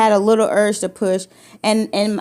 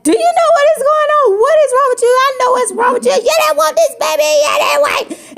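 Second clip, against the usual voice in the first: very high, loud, fast accusing scream; hysterical screaming; 5 dB louder than usual. Retold or imitated. Accusing her husband of not wanting the baby, hysterical scream. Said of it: "And I'm just like".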